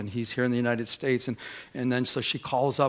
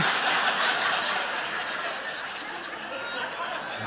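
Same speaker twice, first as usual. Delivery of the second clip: breathless laugh; 10 semitones higher, 6 dB quieter than usual. Real, not acted. Breaks up after the punchline.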